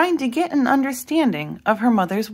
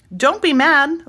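pleading; 7 semitones higher, 4 dB louder than usual. A narrator's reading. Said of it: "Libby pleaded".

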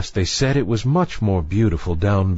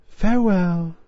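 put-on high, light girlish farewell; a little mermaid bidding goodbye; young mermaid voice; 9 semitones higher than usual. A narrator's reading. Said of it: "so she said".

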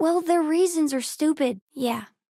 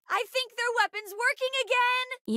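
high, alarmed call; high-pitched urgent report; urgent warning; 10 semitones higher than usual. Acted. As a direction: warn the crew, voice pitched way up. Calling out a combat warning.

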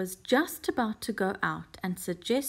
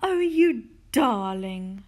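loud, slow, adoring exclamation; delighted cry; 2 semitones higher, 8 dB louder, slower than usual. A narrator's reading. Missus Silver stroking the tortoise, crying out adoringly. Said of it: "she cried".

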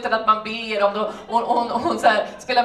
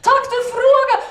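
put-on much higher, loud, eager Russian accent; excited Russian neighbour; 14 semitones higher, 4 dB louder than usual. Svetlana pulling Elena into the flat, high and eager. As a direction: grab her in, bursting to talk. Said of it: "Och hon bara".

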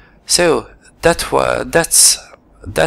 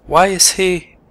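normal talking voice

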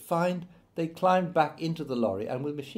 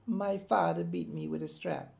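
put-on higher, softer child voice, subdued; a tearful schoolboy answering his teacher, higher and softer; small boy's voice; 6 semitones higher, 3 dB quieter than usual. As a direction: speak like a hurt eleven-year-old. Eleven-year-old boy answers teacher.

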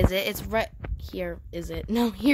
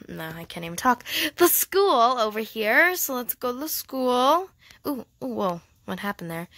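normal talking voice

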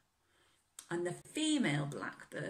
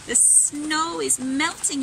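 slow, louder wondering; excited discovery; 10 semitones higher, 13 dB louder, slower than usual. A narrator's reading. Robin noticing the snow melting, slow and excited. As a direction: announce the melt with wonder.

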